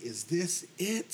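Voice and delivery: high, slow plea, a mild yell; retold yelling. Man retelling his cry to God, mock yell. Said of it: "I yelled at God".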